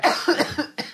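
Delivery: choking cough; sputtering cough; coughing up water. Real, not acted. That went down the wrong pipe.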